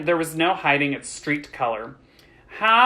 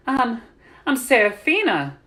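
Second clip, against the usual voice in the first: higher, hesitant blurt; stumble, then blurt the name out; 8 semitones higher than usual. A narrator's reading. Girl gives her name before she can help herself, blurting. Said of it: "she blurted out".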